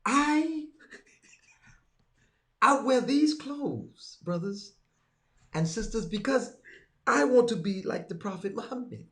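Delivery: mock khutbah delivery, pitched high; preacher impression. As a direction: imitate a preacher with long pauses between phrases. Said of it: "the version of how he gives the khutbah".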